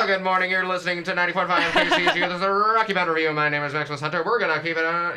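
cheesy morning DJ, fast and loud; radio host voice. Acted. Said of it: "If we sounded like a T V radio host".

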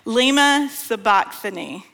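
usual speaking voice

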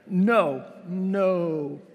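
put-on high, loud little-kid "no, no"; toddler refusing. Toddler who just learned no, loud high refusal. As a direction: refuse like a toddler with her new word. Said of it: "So she's like".

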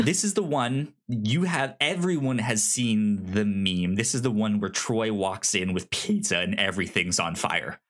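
usual speaking voice

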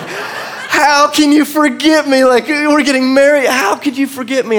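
high, slow laughing mock of the wife; upset wife. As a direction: play the hurt bride with a laugh. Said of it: "she was like".

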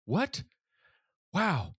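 high rising disbelief, then wonder; astonished. Acted. Student hearing the captain say he can come, astonished. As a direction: act out stunned surprise, pitch jumping up.

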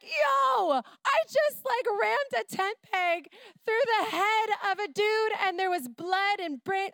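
high, loud mock panic; freaked-out shout. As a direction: blurt it out in a frantic rush. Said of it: "I would be like".

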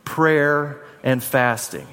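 slow, drawn-out congregational answer; slow call and response. Shown as a demonstration. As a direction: say it slowly with the crowd. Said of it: "Say 'em with me".

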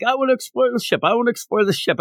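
put-on high, eager little-kid voice; lightly voiced child, pitched well up; excited child. Anya wants to explore the ship, excited child.

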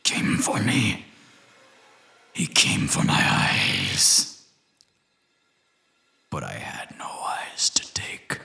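slow raspy whispered monster voice; breathy otherworldly whisper; eerie creature whisper. Acted. Creature voice speaking of eyes.

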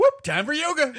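cheery exclaim. Acted.

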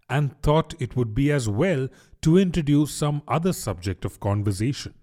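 usual speaking voice

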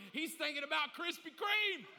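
a high burst of laughter into a shouted punchline; laughing out loud. Real, not acted. Preacher laughing at his Krispy Kreme joke, laughing loudly. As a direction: crack up at your own joke.

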